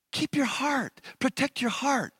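ordinary delivery

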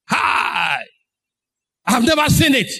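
high, drawn-out exclamation of wonder; amazed. Acted. Onlooker has never seen such a miracle, amazed. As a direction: cry out in acted astonishment.

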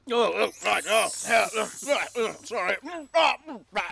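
lightly voiced hapless knight; knight's voice. Asked to do the knights, light knight's voice.

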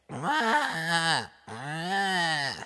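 high-pitched mock wail; imitated bawling; imitated screeching cry. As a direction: wail like a screaming child, high and drawn out. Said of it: "a loud screaming and screeching".

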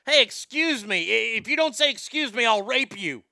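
mock shopper outburst. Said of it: "Like, if you're in a Target".

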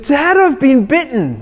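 put-on high, earnest little girl; young daughter announcing she's been bitten; daughter's voice. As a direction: play the stung eight-year-old calling to Dad.

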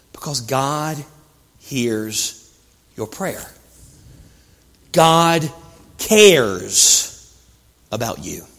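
low, soft, one word at a time; solemn assurance. Acted. Preacher assuring listeners God cares, softly solemn.

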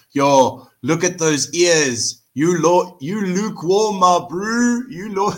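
high, loud mocking taunt; hammed-up teasing bro voice; mock chat troll. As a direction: jeer at him like a rowdy commenter. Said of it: "they were like".

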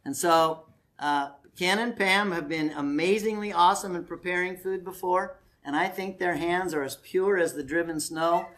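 ordinary talk, nothing marked

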